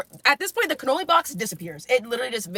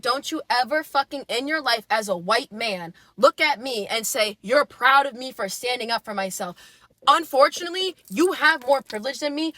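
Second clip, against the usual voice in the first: ordinary talk, nothing marked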